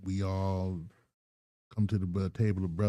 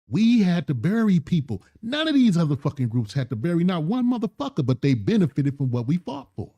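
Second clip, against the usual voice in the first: loud, raised, heated outburst; furious; 6 semitones higher, 6 dB louder than usual. Real, not acted. Host venting to his co-host, angry and loud. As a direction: hammer it home with rising anger. Angry rant about buried leaders.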